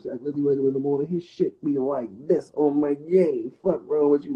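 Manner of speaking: ordinary talk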